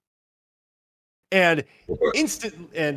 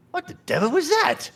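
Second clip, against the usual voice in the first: startled Georgie; 12 semitones higher than usual. Acted. Georgie hears a shriek outside the church, startled and high. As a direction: pitch jumps up high on a startled question. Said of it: "Georgie is all".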